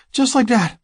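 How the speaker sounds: high, breathy female voice; breathy woman. Acted. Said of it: "she breathes".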